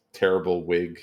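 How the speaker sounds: ordinary talk, nothing marked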